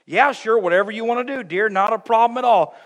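husband agreeing to anything without listening; mock distracted agreeing. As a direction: brush her off cheerfully without listening. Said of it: "Husbands, you ever volunteer".

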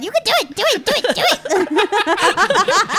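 high, laughing chant of encouragement; cheering on. Acted. Goblin cheers on a friend stuck in the railing, cheering. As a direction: egg them on in a high, excited voice. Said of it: "Shingle Shanks is over there cheering him on".

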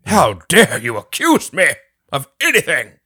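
hammed-up offended protest, slow; outraged. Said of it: "No, but that was good".

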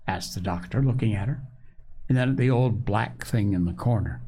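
ordinary delivery, nothing marked

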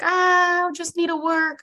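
high, whiny overworked sigh; mock overworked person. Host mocking people who won't take time off, high whine. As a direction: play the tired worker who can't stop. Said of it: "most people are like".

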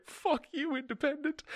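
very high squeaky taunt; shrill taunt. Acted. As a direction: screech it like a gleeful villain. Shrill taunt, first take.

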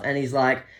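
usual speaking voice

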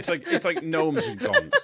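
high, slow giggling words; laughing. Real, not acted. Laughing while explaining gnomes.

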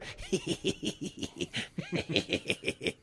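long wheezy cackle; breathy, high mischievous wheeze; Kirk's gotcha laugh. Retold or imitated. Imitating dad after he got you, wheezy laugh. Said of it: "that was his fucking laugh when he got you".

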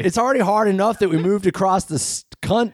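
put-on high, fast, complaining teenager; whiny teen. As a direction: whine it like a sulky senior.

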